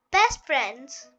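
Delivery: ordinary delivery, nothing marked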